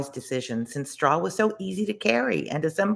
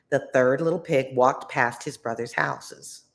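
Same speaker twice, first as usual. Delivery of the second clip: usual speaking voice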